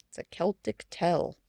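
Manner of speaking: plain reading voice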